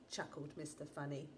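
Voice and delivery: ordinary delivery, nothing marked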